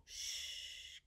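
soft, long shush; hushing. Pretend. Storyteller puts teddy bear to sleep, hushing.

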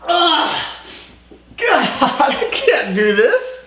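high helpless laughter; laughing. Real, not acted. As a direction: laugh through the words, giving up. Player giving up, laughing.

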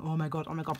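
usual speaking voice

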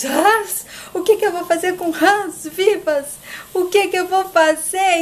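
high, indignant questioning; hammed-up indignant astonishment; appalled disbelief. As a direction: react in high-pitched dismay. Olga appalled by gift of frogs.

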